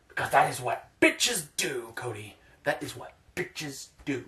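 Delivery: a lightly voiced fighter's whispered confession to Cody; mocking Chael Sonnen. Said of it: "I wanna walk up to his fake ass".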